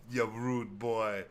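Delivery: very slow put-on Jamaican taunt; mock Jamaican accent. Castaway greeting visitors with a slow mock Jamaican taunt. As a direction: stretch it out like a rude boy. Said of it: "crazy Jamaican accent".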